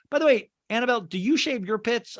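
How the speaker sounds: ordinary delivery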